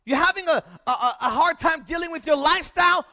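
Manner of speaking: fiery pulpit exhortation; full-force preaching. Acted. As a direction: preach it to the back row. Preaching the storm passage.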